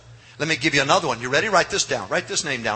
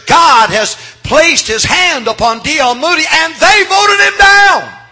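very high, very loud, slow on voted him down; preaching climax shout; 11 semitones higher, 11 dB louder, slower than usual. Real, not acted. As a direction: hammer the climax, drawing out the last words. Climax of sermon.

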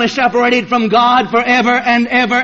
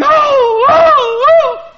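high, loud crying wails; loud anguished oh-oh-oh cries; wailing of the damned; 6 dB louder, much slower than usual. Retold or imitated. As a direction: wail like a lost soul in torment. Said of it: "weeping and screaming".

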